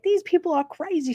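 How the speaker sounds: high-pitched mock yell, no real volume; retold yelling. Lady complains about crazy drivers, retold yelling. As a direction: imitate an exasperated lady's high-voiced outburst. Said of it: "the lady's yelling".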